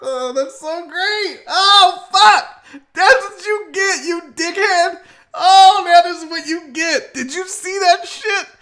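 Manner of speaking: high-pitched, laugh-choked exclaiming; gleeful outburst. Real, not acted. Host reacting to the crash clip, gleeful outburst.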